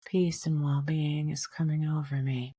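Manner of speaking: softly whispered, breathy affirmation; a quiet whispered mantra for the inhale. Shown as a demonstration. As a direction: whisper it to yourself like an inner mantra. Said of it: "just remind yourself".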